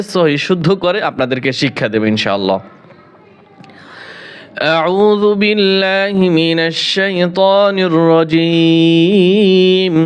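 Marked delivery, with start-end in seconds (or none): 4.6-9.9 slow, drawn-out tajweed chant; Quran recitation. Acted. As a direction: recite each word with long held vowels. (ধীরে টেনে টেনে কোরআন তিলাওয়াত)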